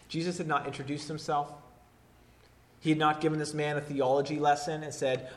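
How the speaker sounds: ordinary talk, nothing marked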